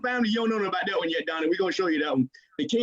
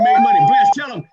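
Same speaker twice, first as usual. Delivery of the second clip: excited shout; 13 dB louder than usual. Real, not acted. Hyping up the Zoom audience.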